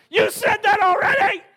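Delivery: put-on child yelling from high up the wall; loud, high, exasperated shout; frustrated shout. Son yelling down at dad from the wall, exasperated shout. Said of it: "and he goes".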